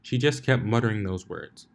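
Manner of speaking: plain reading voice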